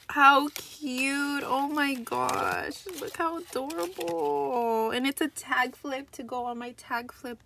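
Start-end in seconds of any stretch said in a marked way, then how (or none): none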